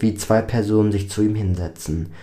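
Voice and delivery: plain reading voice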